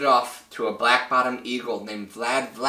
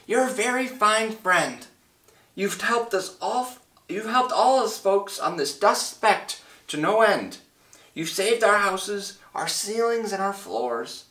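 high-pitched tiny character voice; small speck voice; 9 semitones higher than usual. A narrator's reading. Tiny mayor thanks Horton for saving the town, high small voice. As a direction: speak as someone minute, pitched well up. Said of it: "came the voice".

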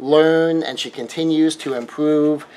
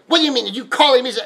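irritated husband; 8 semitones higher, much faster than usual. Acted. Husband lashes out at wife.

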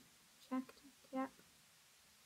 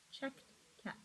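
whispered, much softer; 15 dB quieter than usual. Shown as a demonstration. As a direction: drop to a hushed whisper for the reveal. Reading a picture book aloud.

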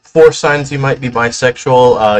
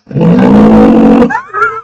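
evil laugh; 14 semitones lower, 6 dB louder than usual. Pretend. Villain laugh after boast.

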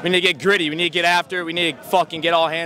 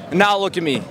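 ordinary talk

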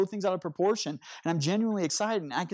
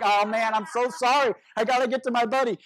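higher, louder, rushed sorry; frantic apology; 7 semitones higher, 6 dB louder than usual. Retold or imitated. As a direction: blurt the apology while already leaving. Frantic, late, apologizing in rain.

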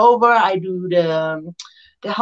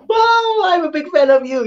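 a much higher, thrilled wow; starstruck gushing; 10 semitones higher than usual. Retold or imitated. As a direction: gush back at a hero, starstruck. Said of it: "And I'm like".

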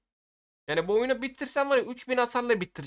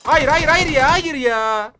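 loud, high protesting cry of no; dismayed shout; 6 semitones higher, 7 dB louder, slower than usual. Real, not acted. Gamer losing a fight yells at the screen, loud and high. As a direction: yell it as the fight is lost.

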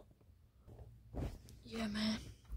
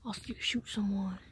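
usual speaking voice

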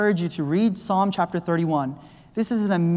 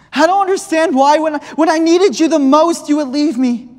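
pleading; 10 semitones higher, 8 dB louder than usual. Acted. Dreamer questions the Lord.

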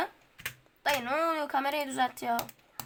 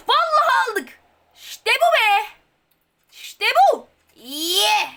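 higher, louder triumphant shout; victory cheer; 6 semitones higher, 6 dB louder, slower than usual. Real, not acted. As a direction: won the round. Victory celebration after winning. (zafer coşkusu)

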